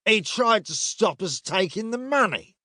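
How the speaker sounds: high-pitched soldier's complaint; protesting soldier. A narrator's reading. Redheaded soldier excusing the beating of a priest, high protest. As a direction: push it high, self-justifying.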